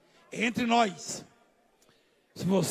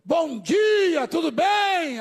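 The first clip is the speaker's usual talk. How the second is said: loud bright hello, higher; cheery greeting; 6 semitones higher, 6 dB louder, much slower than usual. Retold or imitated. Greeting a stranger on the road, loud and cheerful. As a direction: call a friendly hello down the road. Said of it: "viu alguém".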